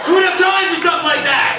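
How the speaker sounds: loud, high incredulous shout; mock outrage. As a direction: shout it in disbelief. Mock outrage at ad's claim.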